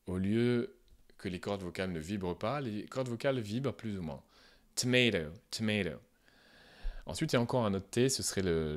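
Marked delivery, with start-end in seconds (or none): 4.8-6.0 tomato with the second T voiced like a D. Shown as a demonstration. Teacher repeating the voiced T of tomato. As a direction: let the vocal cords buzz on the last T of tomato. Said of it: "C'est un T qui est voisé".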